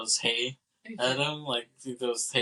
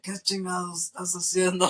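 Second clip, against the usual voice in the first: pitched-up Mexican Spanish outburst; Spanish curse, raised higher; Spanish yell; 7 semitones higher than usual. Retold or imitated. Old Mexican man cursing at elephant, in Spanish. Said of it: "he throws in a lot of phrases in Spanish".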